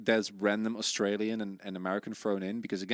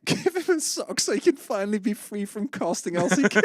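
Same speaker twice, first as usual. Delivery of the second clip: silly high voice; 9 semitones higher, 3 dB louder than usual. Pretend.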